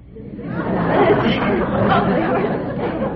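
a big high laugh, breaking up at her own story; bursting laugh. Real, not acted. Elevator girl laughing at her own joke. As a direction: laugh out loud at the punchline.

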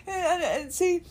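sobbing out the realization; crying. Real, not acted. Realizing pain is gone, crying.

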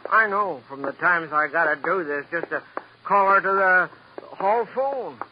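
usual speaking voice

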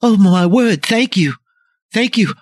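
high, loud, relieved woman's voice; breathless rescued woman. Acted. Rescued woman thanking the men who freed her. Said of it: "she's like".

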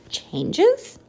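high rising question; puzzled. Acted. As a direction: ask it puzzled. Puzzled little bat.

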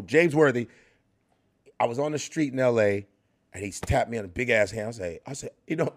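ordinary delivery

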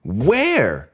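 put-on high, alarmed little girl voice; imitating a frightened young daughter; alarmed child. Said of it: "My daughter, alarmed".

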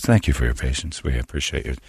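mock pleasant hotline voice; soothing phone rep. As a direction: imitate a rep soothing an angry caller. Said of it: "It's always a real pleasant voice".

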